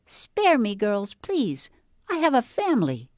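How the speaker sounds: high squeaky little critter pleading; squeaky squirrel voice. Retold or imitated. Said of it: "in a squeaky voice I'd say".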